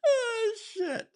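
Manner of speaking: sudden squeal of laughing surprise; high surprised cry, half laughing; surprised yelp. Real, not acted. Laughing at the chat.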